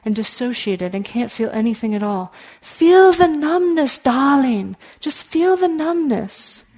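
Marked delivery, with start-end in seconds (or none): 2.6-6.7 put-on slow, high, loud German-accented elder. Teacher imitating elder Ruth Denison to numb students, German accent. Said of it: "in her German accent".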